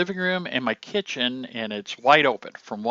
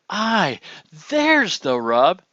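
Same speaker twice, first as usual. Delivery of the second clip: higher and slow, as if it just dawned on you; excited discovery; 6 semitones higher, 6 dB louder, slower than usual. Shown as a demonstration. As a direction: Aye jumps up high, there's the rub drawn out and slow. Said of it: "it's like I'm getting excited".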